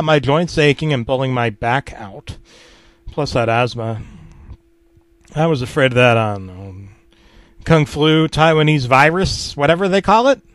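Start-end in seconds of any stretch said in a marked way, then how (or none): none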